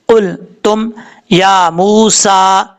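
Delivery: slow recited कुल तुम या मूसा with the vowels drawn out; Quranic recitation. Shown as a demonstration. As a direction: recite it slowly, stretching each vowel. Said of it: "practice के तौर पे पढ़ते हैं". (धीमी तिलावत में कुल तुम या मूसा)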